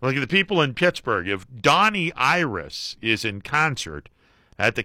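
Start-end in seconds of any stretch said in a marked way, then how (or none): none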